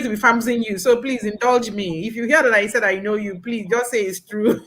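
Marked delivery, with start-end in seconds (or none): none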